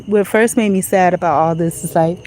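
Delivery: ordinary talk, nothing marked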